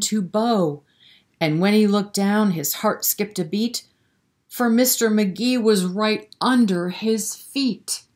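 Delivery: sing-song rhyming storybook read; lilting bedtime-story narration; reading to children. A narrator's reading. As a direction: read the rhyme aloud to little kids. Reads a rhyming picture book.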